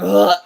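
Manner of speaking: loud mock retch of disgust; disgusted groan. Granddaughter hearing she ate rabbit, loud disgusted groan. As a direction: groan it out, revolted.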